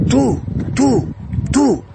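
high impassioned calls of tú; fervent cry. Retold or imitated. Widow crying out to God in prayer, high impassioned call.

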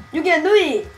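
high, loud exaggerated cheer; reading teacher impression. Player shows friend how it's supposed to sound, loud mock teacher. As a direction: demonstrate the teacher's catchphrase at full blast. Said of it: "It's supposed to be like this".

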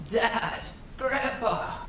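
very high, drawn mimicking cry; eerie echoed call. A narrator's reading. Unseen thing echoing the hunter's call, eerie high mimicry. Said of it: "echoed back to me".

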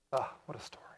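breathy whisper of wonder; whispered awe. Real, not acted. Preacher marvelling at the Lazarus story, whispered. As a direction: savour the story under your breath. Awed aside after the miracle.

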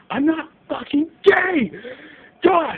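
shouted high denial; angry yell. Real, not acted. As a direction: yell it out, fed up.

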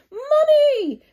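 high, loud call of Mummy; Peppa calling Mummy. A narrator's reading. Peppa running in calling her mother, loud and high. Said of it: "cried Peppa".

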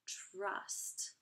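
ordinary talk

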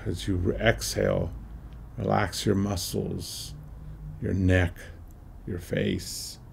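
slow meditation voice with long pauses; measured, spacious meditation leading in short phrases; guided meditation. Acted. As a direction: guide listeners through each breath, unhurried, letting phrases settle.

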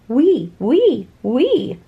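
shouted. Acted. The alpacas in the French restaurant, mild shout. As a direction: toss it off like cheeky alpacas. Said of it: "shouted the alpacas".

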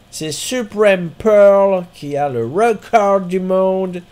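put-on slow, drawn-out American accent reading the names; hammed-up American accent on English names. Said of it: "je fais mon accent américain". (accent américain exagéré)

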